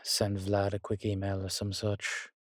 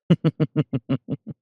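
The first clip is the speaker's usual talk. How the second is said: loud, higher rippling laugh; amused laugh; 7 semitones higher, 9 dB louder than usual. Acted. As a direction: laugh in a quick falling run. Character chuckles after inviting companion out.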